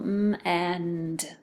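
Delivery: "M, and" as onset, pause, then rime; sounded out. Shown as a demonstration. Teacher breaking down a second syllable, "M, and" in separate sounds. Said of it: "And the second syllable".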